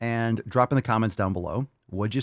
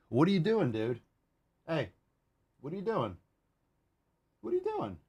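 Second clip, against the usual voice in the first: soft, higher-pitched aside to a pet; quiet questioning; 6 semitones higher, 8 dB quieter than usual. Real, not acted. Host asking the Tainty Man what he's doing, quietly. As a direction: softly check on the pet, away from the listeners. Calling softly to his chewing dog.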